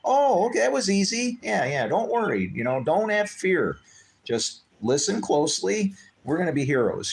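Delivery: mock reassuring cheer. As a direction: hype up a nervous caller as if it's an adventure. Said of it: "And it's like".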